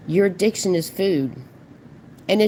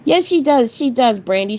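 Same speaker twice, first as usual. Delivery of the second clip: mocking glee; 6 semitones higher, 5 dB louder than usual. Real, not acted. Gleeful mocking agreement.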